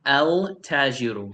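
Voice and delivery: "el tajiru" with a full L before the t; separate, clear "el" before "tajiru"; L wrongly sounded. Shown as a demonstration. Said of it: "No, that's wrong".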